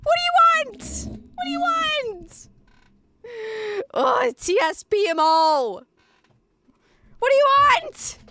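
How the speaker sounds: high, loud exasperated shouting; exasperated yell. Real, not acted. Streamer fed up with women, high exasperated shouting.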